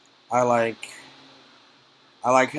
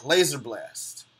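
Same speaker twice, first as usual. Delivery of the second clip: normal talking voice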